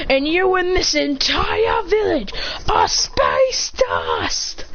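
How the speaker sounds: high, drawn-out mock battle shout; villainous threat. Clone threatening Sakura and her village, shouted threat. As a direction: declaim a threat, stretching the words.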